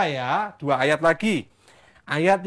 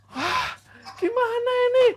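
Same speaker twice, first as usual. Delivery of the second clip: acted panic. Storyteller voices the student who lost the axe, high panicked wail. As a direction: wail in hammed-up alarm. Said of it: "Dia langsung panik ya".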